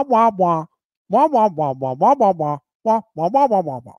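muffled trombone-like wah-wah babble; mock cartoon adult wah-wah drone; Charlie Brown parents. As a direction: mimic the cartoon grown-ups' wordless wah wah. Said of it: "all you hear them when the parents talk is wah".

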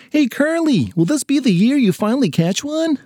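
put-on high-pitched kid calling out a question; schoolkid teasing curiously. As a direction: pipe up like a curious classmate.

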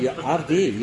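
ordinary delivery

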